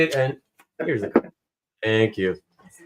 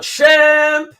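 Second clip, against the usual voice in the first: "Champ!" called out high and loud; mock scream; 9 semitones higher, 4 dB louder than usual. Host reading a viewer's cheer, mock shouting. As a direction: shout "Champ!" like a fan in chat. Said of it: "Marcus screaming out".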